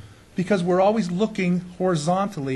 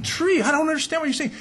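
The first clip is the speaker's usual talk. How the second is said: puzzled; 9 semitones higher than usual. Retold or imitated. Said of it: "And I'm saying".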